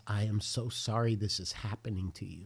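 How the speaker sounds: soft, low, tender apology; voicing a quiet, heartfelt sorry; sorrowful comfort. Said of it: "He is weeping".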